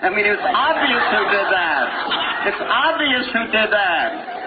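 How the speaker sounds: high, laughing disbelief. Real, not acted. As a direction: laugh the words out. Laughing retelling.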